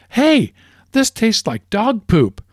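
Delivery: high, slow voice of a grossed-out customer; disgusted customers. Acted.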